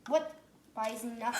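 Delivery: ordinary talk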